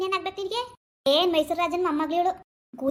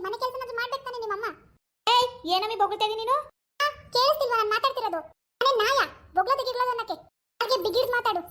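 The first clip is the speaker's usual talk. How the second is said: put-on a higher, nagging young wife; daughter-in-law role; 4 semitones higher than usual. Actor voices daughter-in-law scolding husband about mother. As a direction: complain sharply to husband about his mother.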